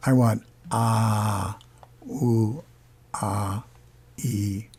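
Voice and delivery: slow drawn-out vowels; slow vowel demo. Shown as a demonstration. Teacher correcting the last vowel demonstration. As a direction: stretch each vowel out slowly. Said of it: "that is more like this".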